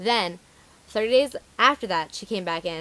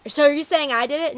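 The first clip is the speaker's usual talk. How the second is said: higher, louder mock accusation; indignant customer; 7 semitones higher, 7 dB louder than usual. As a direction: imitate a customer taking offence. Customer argues back, acted out.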